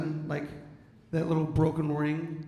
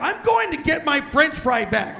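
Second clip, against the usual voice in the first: high, loud, slow indignant yell; mock indignant; 9 semitones higher, 10 dB louder than usual. Man telling his wife he'll take the fry back, mock indignant yell. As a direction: declare it with loud petty resolve.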